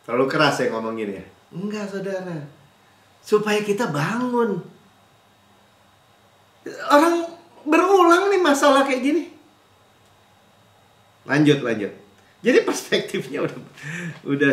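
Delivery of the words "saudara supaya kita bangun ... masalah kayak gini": ordinary talk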